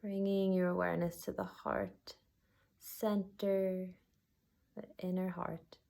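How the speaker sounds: unhurried body-scan guidance with long pauses; guided meditation. Acted. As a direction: guide them gently inward, letting each phrase settle.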